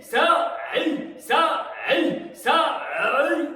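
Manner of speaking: loud high shouted hey and ça; shouting. Shown as a demonstration. Teacher leading the class in full-force shouts. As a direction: throw each cry out from the belly. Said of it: "On y va ensemble".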